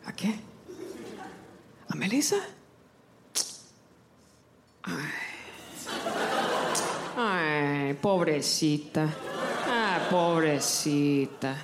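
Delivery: low, slow pitying abuela; drawn-out pobrecita, full of pity; great grandma voice. Retold or imitated. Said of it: "my great grandma's voice".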